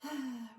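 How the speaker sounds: high open-mouthed sighing breath out; audible sigh. Shown as a demonstration. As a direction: sigh out on a falling "ha". Demonstrating breaths for the class.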